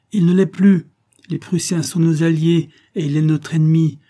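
loud, high-pitched scolding; angry rebuke. A narrator's reading. Wife angrily rebukes husband.

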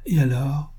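a hushed, eager question; breathless suspense. A narrator's reading. Bill presses the girl, holding his breath in suspense. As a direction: hold your breath and ask. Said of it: "suspendant son haleine".

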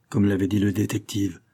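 usual speaking voice

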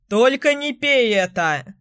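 narrator's mild call over the shoulder; lightly called warning, voice a little lifted. A narrator's reading. Tom walking away from the girl with his beer, calling out. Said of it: "крикнул он, удаляясь".

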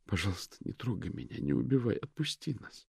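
soft, terrified begging whisper; barely voiced, desperate plea; whispered pleading. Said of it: "Губы её шевелились, повторяя ... мольбы".

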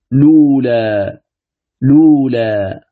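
"لولا" drawn out slowly as luu-laa; hamza dropped. Shown as a demonstration. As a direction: start "لولا" straight on the lam, both vowels long. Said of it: "ماذا تصير الكلمة؟".

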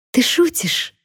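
high, excited cry of disbelief; high-pitched exclamation. Acted. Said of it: "высоким голосом воскликнула".

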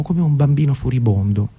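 normal talking voice

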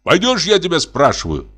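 high-pitched insistent demand; angry shout. A narrator's reading. Hunter demands an answer from forester, mild angry shout. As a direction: press him angrily without full volume. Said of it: "крикнул охотник".

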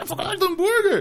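high, incredulous echo; imitated disbelief. Storyteller imitating friends doubting the film exists, high-pitched disbelief. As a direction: squeal it back as a mocking question. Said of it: "todo mundo que eu conhecia falava".

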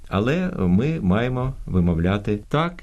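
ordinary talk

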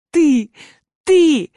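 loud, high tearful accusation; choking on tears. A narrator's reading. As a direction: cry out the blame through tears. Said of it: "повторяла Талана вновь и вновь, давясь слезами".

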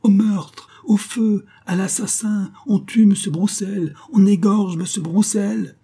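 high, loud falsetto alarm; falsetto yell. A narrator's reading. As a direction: howl murder in a falsetto. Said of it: "hurla sur un ton de fausset".

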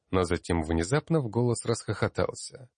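plain reading voice

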